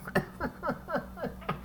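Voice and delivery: high unvoiced wheezing laugh; breathy laugh. Real, not acted. Laughing at the cellmate's hustle excuse, a wheezing laugh. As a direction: let a soft wheeze of a laugh slip out.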